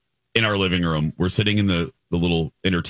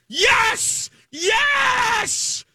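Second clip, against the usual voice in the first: much higher, slow chanted cheer; gleeful cheering; much slower than usual. Retold or imitated. Host reenacts cheering as illegally parked cars get towed. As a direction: cheer the tow trucks on like a stadium fan. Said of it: "I was almost as excited".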